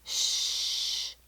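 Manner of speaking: whispered "Shh" held long; a long airy "Shh" with no voice; sh sound demo. Shown as a demonstration. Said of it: "S H says".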